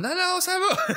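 very high squeaky denial; mock fake fine. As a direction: pretend everything is fine while bleeding. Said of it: "tu vas pas faire comme".

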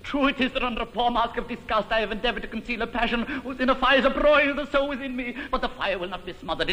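very fast, breathless torrent of passion; mock-ardent suitor gabbling his passion; gushing declaration. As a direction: rattle off the love speech in a hammed-up rush. Hammed-up rapid love declaration.

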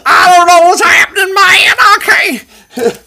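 high laughing yell; laughing shout. Pretend. Joking time-travel panic.

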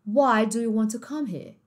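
slow British question, here as "heah"; British accent. Shown as a demonstration. Family asking the au pair, British accent. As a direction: ask slowly in British, no final R. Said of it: "Agora, num outro sotaque".

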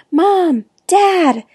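high, loud yell for the parents; yelled call. A narrator's reading. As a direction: call out urgently up the stairs. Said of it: "yelled, racing up the stairs".

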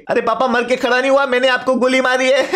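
put-on high kid voice, tipping into laughter; teasing son. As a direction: play the son gleefully confessing to papa. Son teases papa after shooting him.

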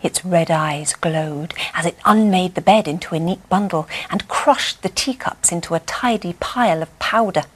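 ordinary delivery, nothing marked

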